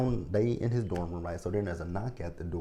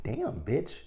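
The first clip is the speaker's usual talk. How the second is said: mock-sweet jab, climbing high to the last word; teasing high sing-song smile; sassy retort; 9 semitones higher, much slower than usual. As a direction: pitch leaps way up on smile. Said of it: "I'm like".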